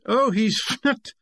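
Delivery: slow, drawn-out "Oh" rising into a snorted "fnut"; imitating the fnut noise. Peters explains the noise, lightly performing it himself. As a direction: stretch the oh, then snort out fnut. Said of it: "Very good at it".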